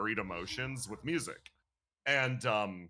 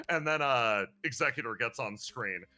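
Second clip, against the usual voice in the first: ordinary talk, nothing marked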